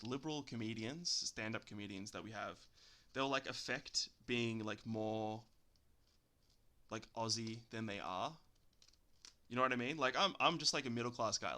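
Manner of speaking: normal talking voice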